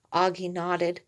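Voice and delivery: ordinary delivery, nothing marked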